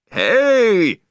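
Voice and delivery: high, loud angry yell; angry shout. A narrator's reading. Beachgoer yelling at the owner, high angry shout. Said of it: "somebody shouted angrily".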